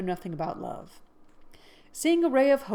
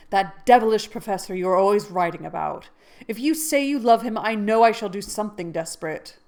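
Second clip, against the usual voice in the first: much louder, heated; jealous threat; 2 semitones higher, 8 dB louder than usual. Acted. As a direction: threaten in a rage.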